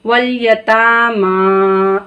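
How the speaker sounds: वल यतामा with ता and मा stretched long; slow demo stretching the vowels of यतामा; drawn long vowels. Shown as a demonstration. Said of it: "हम ता को खींच रहे हैं".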